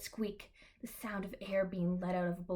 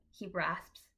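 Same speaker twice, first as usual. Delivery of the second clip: ordinary delivery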